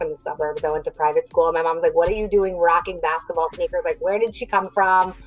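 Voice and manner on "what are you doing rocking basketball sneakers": normal talking voice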